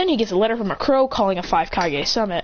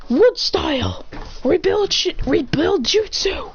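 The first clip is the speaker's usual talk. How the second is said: higher, drawn-out jutsu name called out; slow, higher dramatic technique call; 7 semitones higher, slower than usual. Acted. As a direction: announce the technique like an anime ninja. Character calls out jutsu name.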